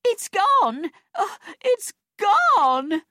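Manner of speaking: very high, loud wail of loss; distraught shouting. A narrator's reading. Beach woman to everyone about her lost bag, high wailing shout. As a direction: wail it out, stretching the last cry. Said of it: "she shouted".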